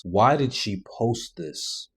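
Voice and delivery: each word slowed, stress on why; slow disbelief. Real, not acted. Host baffled by the posted video, slowed repeat. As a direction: drag it out in disbelief.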